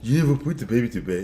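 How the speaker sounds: low-pitched re-enactment of a reproach; imitating own tone. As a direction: replay your own questioning tone. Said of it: "is this what I did".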